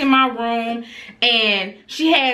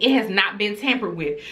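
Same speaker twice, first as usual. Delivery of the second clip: ordinary talk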